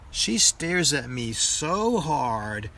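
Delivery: plain reading voice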